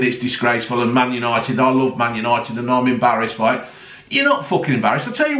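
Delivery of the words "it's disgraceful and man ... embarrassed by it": mocking Rio. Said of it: "giving it all the big".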